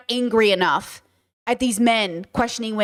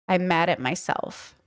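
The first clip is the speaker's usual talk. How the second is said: softer, lower, slow and heavy; quiet sad echo; 5 semitones lower, 5 dB quieter, slower than usual. Retold or imitated. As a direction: let it sink in, almost to herself. Quiet repeat of Zuko's line.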